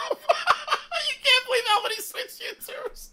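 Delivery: very high, squeaky voice through laughter; laughing mock squeal. Streamer echoes a viewer's complaint, high laughing mockery. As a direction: echo it in a high giggling falsetto.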